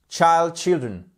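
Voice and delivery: ordinary talk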